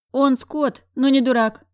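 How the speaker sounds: high, loud scornful verdict; emphatic, dismissive friend's quip; wry snort. A narrator's reading. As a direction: pronounce it with sardonic scorn. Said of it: "хмыкнула Зайка".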